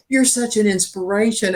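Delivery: usual speaking voice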